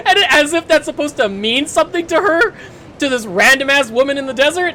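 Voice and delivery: words pushed through laughter; laughing while talking. Real, not acted. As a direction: talk while still cracking up. Laughing while retelling scene.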